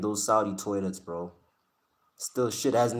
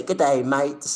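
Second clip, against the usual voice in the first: playful mock Australian accent, pitched up; Aussie accent; 3 semitones higher, 4 dB louder than usual. Streamer promising to greet X in a mock Australian accent. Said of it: "The Aussies and their accents".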